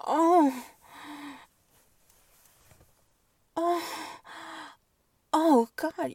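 high, loud cries of pleasure; loud moans. Acted. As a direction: let the moans break out. Moaning in pleasure.

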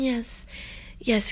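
normal talking voice

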